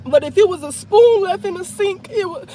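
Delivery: real tears, voice breaking high; shaking voice. Real, not acted. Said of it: "my voice is shaking right now".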